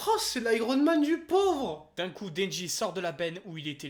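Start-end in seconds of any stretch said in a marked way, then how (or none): 0.1-1.9 loud, high, slow exclamation; mock amazed. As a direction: gasp it out in mock awe. Mocking exclamation.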